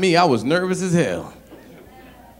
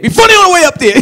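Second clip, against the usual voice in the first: much higher, louder impatient call; demanding heckler; 13 dB louder, faster than usual. Retold or imitated. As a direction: shout it at the comic as he walks up.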